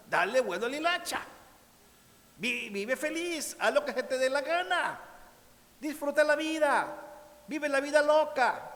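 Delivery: high and loud, partying voice; mock invitation to live it up; carefree hedonist. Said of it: "esa expresión mexicana".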